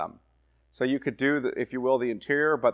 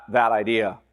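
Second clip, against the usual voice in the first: normal talking voice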